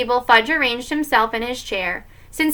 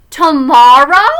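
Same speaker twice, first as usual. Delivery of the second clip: very loud, drawn-out rising question; higher, astonished little-kid question; excited surprise; 4 semitones higher, 12 dB louder, slower than usual. Acted. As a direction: cry it out like an eager child. Excited child asks about baby.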